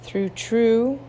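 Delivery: normal talking voice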